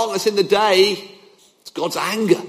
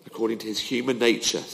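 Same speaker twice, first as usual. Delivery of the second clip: ordinary talk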